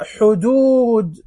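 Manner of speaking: "حدود" with the oo stretched long; long oo vowel. Shown as a demonstration. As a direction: draw out "حدود" as hudoood. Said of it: "المد بالواو". ("حدود" بمدّ الواو)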